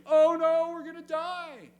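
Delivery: high, loud mock cry of oh no; hammed-up panic. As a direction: ham up the frightened disciples. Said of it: "They are perishing".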